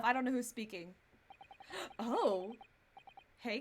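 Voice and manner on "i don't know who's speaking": ordinary delivery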